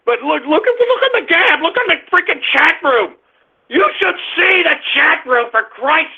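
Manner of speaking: high-pitched real exasperation; agitated, pitch jumping high; exasperated outburst. Real, not acted. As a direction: flare up at the chat room. Exasperated outburst at chat room.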